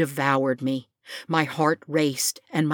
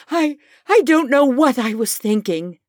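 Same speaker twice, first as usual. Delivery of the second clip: higher, louder, halting confession; trembling voice; 7 semitones higher, 5 dB louder than usual. Acted. Morton admitting his foolishness to Taya, a bit higher with a trembling voice. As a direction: stumble through it, voice shaking. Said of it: "my voice trembling".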